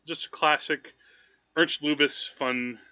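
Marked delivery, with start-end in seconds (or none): none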